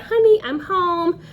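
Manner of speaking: lightly mocking cheerful husband; sing-song homecoming. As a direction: call it out like a sitcom dad walking in. Eric coming home voiced.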